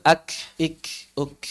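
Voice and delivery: ak ik uk with light air; soft release on each k; correct light hams. Shown as a demonstration. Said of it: "Enggak begitu". (hams yang benar)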